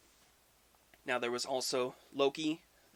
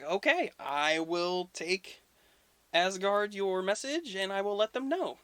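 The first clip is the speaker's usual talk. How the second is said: high, slow, put-on trickster voice; mock-polite messenger god; Loki voice; 6 semitones higher, 3 dB louder than usual. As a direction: stretch it out high and sly. Loki answers the ogre.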